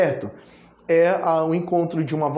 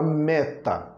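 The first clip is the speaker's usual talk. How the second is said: "meta" with the weight on "me"; "meta" stressed as ME-ta; stress on first syllable; 2 semitones lower, 3 dB quieter than usual. Shown as a demonstration. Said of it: "a sílaba mais forte é a primeira sílaba".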